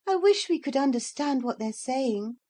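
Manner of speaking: wistful girl, lightly voiced; young girl. Lucy wishes to understand the dogs, girlish and wistful.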